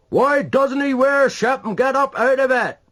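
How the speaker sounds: high, loud exasperated shout. A narrator's reading. Another man on the quay shouting in exasperation. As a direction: holler the question at the ship. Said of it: "shouted another".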